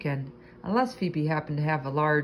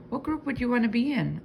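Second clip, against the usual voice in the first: squeaky hamster voice; 6 semitones higher than usual. A narrator's reading. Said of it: "I squeaked to Og".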